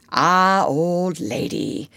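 lightly voiced, drawn-out pretend husband; fond husbandly aside. A narrator's reading. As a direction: say it slowly, playing at old married man. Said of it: "Peter said aside to Wendy".

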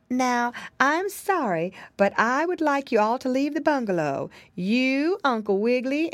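lightly voiced pie lady. Pie lady politely sending the rabbit and pigs away, lightly voiced. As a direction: politely shoo everyone out.

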